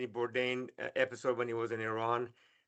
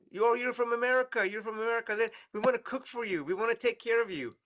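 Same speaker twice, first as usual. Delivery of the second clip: higher, eager welcoming voice, lightly voiced; Iranian shopkeepers welcoming Rick; 8 semitones higher than usual. A host in Iran greets the American visitor, lightly voiced eager welcome. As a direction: play the eager host inviting the American guest in. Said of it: "He said".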